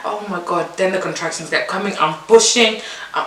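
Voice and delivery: usual speaking voice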